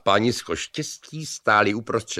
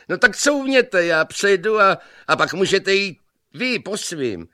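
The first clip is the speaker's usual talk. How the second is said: much higher, loud laughing yell; cocky shout; 11 semitones higher, 5 dB louder than usual. A narrator's reading. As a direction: shout him back off the plank. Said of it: "museli na sebe pěkně hulákat".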